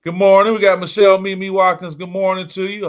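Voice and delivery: loud, high, beaming hello; cheerful live-stream shoutout; excited greeting. Real, not acted. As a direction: call out a big good morning.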